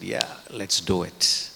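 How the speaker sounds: slow, drawn-out "Yeah, let's do it" with a touch of excitement; mock enthusiasm. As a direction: draw out "let's do it" like eager disciples. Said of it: "they're excited".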